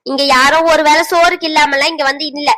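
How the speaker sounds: ordinary talk, nothing marked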